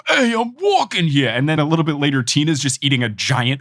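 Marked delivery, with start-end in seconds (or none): 0.1-1.3 put-on much higher, overdone New York accent; mock New York accent. Mocking British filmmakers' idea of New York, hammed-up New York accent. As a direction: bark it like a cliché New York cabbie. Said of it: "British people trying to make New York".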